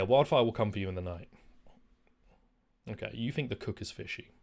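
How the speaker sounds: ordinary delivery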